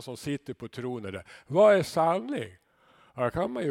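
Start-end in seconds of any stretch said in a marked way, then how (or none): 1.5-2.6 loud, higher, slow scoff; scornful Pilatus. Acted. Pilatus on his throne sneering at the bloodied Jesus, loud and slow. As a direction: throw the question down from the throne.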